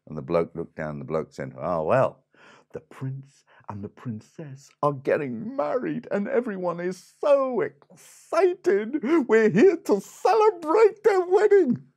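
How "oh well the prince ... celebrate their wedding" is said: building from measured to high, loud, gushing excitement; over-the-top excited townsperson; excited bystander. Acted. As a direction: grow more and more thrilled, drawing it out and climbing to a squeal. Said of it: "talking in an excited kind of the way".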